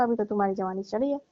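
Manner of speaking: ordinary talk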